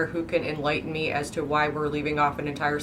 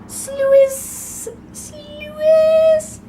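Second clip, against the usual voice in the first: very high, loud squealed sloo-iss; piercing drawn-out squeal; 6 dB louder than usual. Shown as a demonstration. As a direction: squeal it out long, two syllables. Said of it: "like, squeal".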